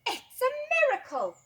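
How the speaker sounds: very high astonished shout; amazed shout. A narrator's reading. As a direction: cry out in wonder. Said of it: "They shouted".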